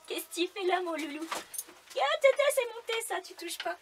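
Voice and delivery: high, loud scolding of a pet; talking to a dog. Real, not acted. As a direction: tell the dog off, half laughing. Dog climbs onto her work.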